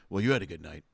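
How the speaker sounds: low, sulky concession; sour grudging remark. Retold or imitated. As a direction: mutter it without looking over. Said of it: "He goes".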